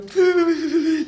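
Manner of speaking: quick high burst of laughter; sudden laugh. Real, not acted. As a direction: laugh at yourself. Laughing while retelling her crying.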